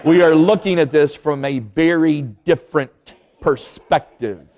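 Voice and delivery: slow, with every consonant bitten off; offended wife answering her laughing husband, slow and crisply clipped; clipped, icy wife. Retold or imitated. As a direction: speak slowly, biting each consonant like a cold rebuke. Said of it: "she started biting her consonants".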